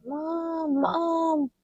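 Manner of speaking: put-on high, thin baby voice calling for mom; baby crying for mom. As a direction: cry out for mom like a tiny child. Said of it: "and crying".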